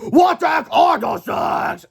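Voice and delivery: high, loud, slow villain shout; frantic shout. Acted. Villain attacked by creatures, high shout. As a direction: yell while swatting.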